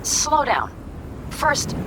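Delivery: startled call to slow down; reining someone in. Acted. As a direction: halt someone who is rushing ahead. Interrupts an alarming, rushed plan.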